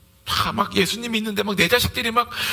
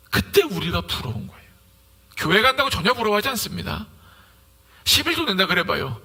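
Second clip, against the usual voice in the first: ordinary delivery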